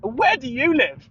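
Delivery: cheery echo. Retold or imitated. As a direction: toss it back sweetly and slowly. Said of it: "And I go".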